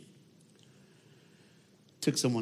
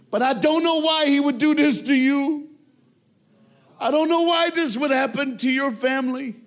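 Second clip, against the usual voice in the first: high, loud, impassioned, building to a shout; over-the-top grief; 9 semitones higher, 6 dB louder than usual. Retold or imitated. Widower Gary telling the girl about his wife, loud and impassioned. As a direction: cry out your confusion at God's plan. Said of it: "he's over the top".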